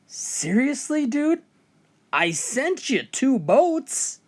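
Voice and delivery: high, slow disbelieving whine; like talking to a fool, slowly; exasperated. Acted. God exasperated punchline.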